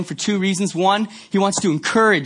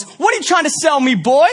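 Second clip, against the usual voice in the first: higher, louder mock angry bus driver; 8 semitones higher, 5 dB louder than usual. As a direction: bark at the kid, mock furious. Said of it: "and goes".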